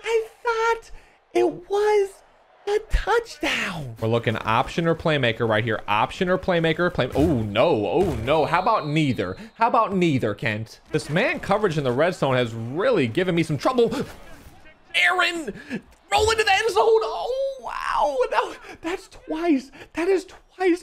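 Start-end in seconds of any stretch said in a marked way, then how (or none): 0.0-4.0 high, slow, half-crying wail; laughing anguish. Real, not acted. Gamer bemoaning a lost touchdown to viewers, laughing anguish. 15.0-20.8 laughing disbelief. Real, not acted. Gamer urging his quarterback toward the end zone, laughing disbelief. Near touchdown again, laughing.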